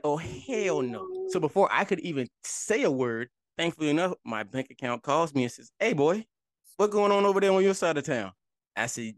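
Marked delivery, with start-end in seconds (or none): 0.0-1.3 slow drawn-out "Oh, hell no"; shocked. Retold or imitated. Retold shock at charges. 5.8-8.3 higher, louder folksy caller; bank caller voice. Retold or imitated.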